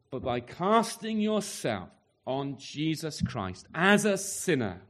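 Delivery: ordinary talk, nothing marked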